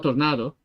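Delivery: Tornado with an open, stressed AH in the middle; Italian-style Tornado. Shown as a demonstration. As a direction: say Tornado as tor-NAH-do, the Italian way. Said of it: "That's the Italian way".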